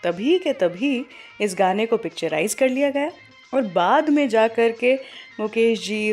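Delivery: normal talking voice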